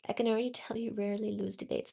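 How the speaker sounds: ordinary talk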